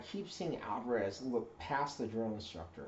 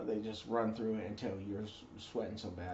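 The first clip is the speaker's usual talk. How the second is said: ordinary delivery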